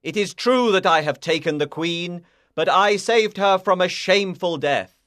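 loud, earnest defence; crying out. A narrator's reading. Lancelot answering the king from castle walls, loud voice. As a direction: project a firm plea across distance. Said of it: "he cried".

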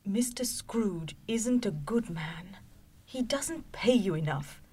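high, whispered grumble about the boss; wife whispering her disapproval to her husband; whispered complaint. Acted. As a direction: hush it so the children can't hear. Mrs Cratchit frowns, complains.

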